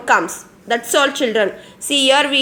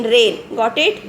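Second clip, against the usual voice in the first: ordinary talk, nothing marked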